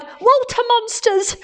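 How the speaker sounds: very high, drawn-out shriek; high-pitched terrified wail; frightened cry. A narrator's reading. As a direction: yelp in panic at monsters. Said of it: "and one cried".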